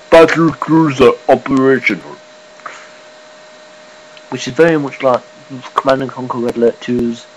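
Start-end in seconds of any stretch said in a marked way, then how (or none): 0.1-2.2 louder, lightly done game-unit announcement; a hint of the StarCraft Battlecruiser unit voice. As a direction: announce it like a starship unit reporting in. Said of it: "me doing the voice of, the StarCraft Battlecruiser".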